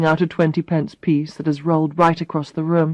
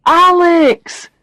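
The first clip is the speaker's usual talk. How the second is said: loud, high greeting shout; calling out; 11 semitones higher, 6 dB louder than usual. Acted. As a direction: call her name across the room. Barry calls from the doorway.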